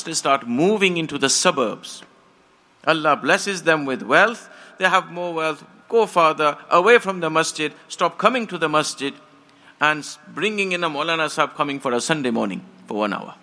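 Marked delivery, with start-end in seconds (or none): none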